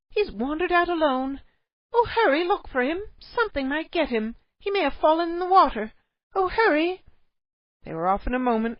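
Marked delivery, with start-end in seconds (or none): 0.1-7.0 high, loud, frantic urging; panicked mother. A narrator's reading. Cave mother urges her husband to find the child, frantic. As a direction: beg him to hurry.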